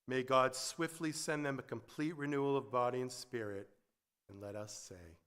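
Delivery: soft, hushed prayer voice, fading to a whisper-quiet amen. Acted. Spoken prayer over soft music.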